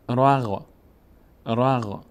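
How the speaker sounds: "را، غ" as one closed syllable "ragh"; ر joined to غین. Shown as a demonstration. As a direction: run the R syllable straight into a vowelless gh. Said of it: "ر باید بچسبه به غین". («رَغ»، ر چسبیده به غینِ ساکن)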